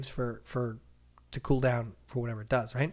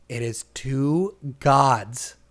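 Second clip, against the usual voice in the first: usual speaking voice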